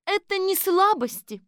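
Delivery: high, loud retort; emphatic high-voiced defence of the chief; Kolbochkina retorting. A narrator's reading. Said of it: "ответила Колбочкина".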